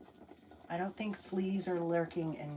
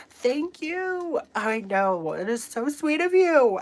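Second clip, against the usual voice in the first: gushing thanks; 6 semitones higher, 8 dB louder than usual. Real, not acted.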